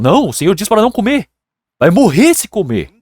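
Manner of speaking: high, quick mock protest; alarmed husband. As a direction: warn her urgently, lightly acted. Husband refuses, warns wife.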